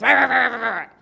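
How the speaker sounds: loud mock-angry babble; attacking rant. Someone coming at us, acted loud attack. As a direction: come at them roaring nonsense. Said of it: "when someone comes at us with".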